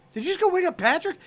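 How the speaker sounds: high, fast disbelief; incredulous Matt. Retold or imitated. Matt asking Joe outside, high and fast. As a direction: ask in disbelief. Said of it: "And Matt's like".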